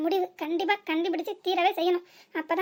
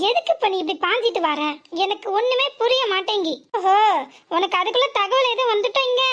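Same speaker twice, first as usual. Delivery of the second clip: angry shouting; 6 dB louder than usual. Acted. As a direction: yell back at the furious mother. Daughter shouts back at mother. (கத்தி பேசுதல்)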